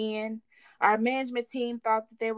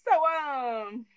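ordinary delivery

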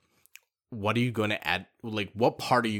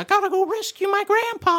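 very high, squeaky cartoon hero; hammed-up kids' dub voice, pitched way up; Yugi. Mocking Yugi off to rescue grandpa, squeaky cartoon cry. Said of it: "he's like".

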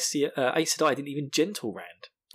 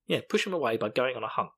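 plain reading voice

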